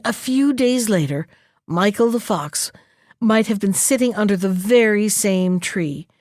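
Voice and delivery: plain reading voice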